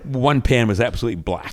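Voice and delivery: ordinary delivery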